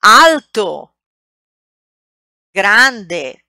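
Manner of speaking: Alto and grande said loud and clear in Italian; model pronunciation. Shown as a demonstration. Teacher models words for students to repeat. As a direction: say each word clearly for repeating. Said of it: "repeat after me".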